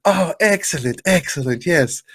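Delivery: high, hammed-up accented delight; badly faked wrestler accent, cheerfully clueless; Iron Sheik. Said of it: "your Iron Sheik is awful".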